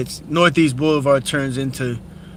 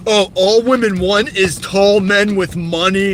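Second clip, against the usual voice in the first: slow, higher, louder mock bro voice; mocking alpha male; 5 semitones higher, 5 dB louder, slower than usual. Vlogger mocking TikTok alpha males, slow exaggerated voice. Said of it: "fake alpha males, like".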